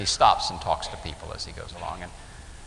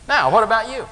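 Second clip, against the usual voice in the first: waitress asking; 12 semitones higher, 10 dB louder than usual. Retold or imitated. Waitress asks the boy.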